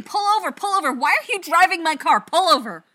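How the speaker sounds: loud, high frantic yell to stop the car; shouting pull over while flailing in the car; panicked shouting. Acted. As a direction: scream at the driver to pull over. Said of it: "flailing and waving and shouting".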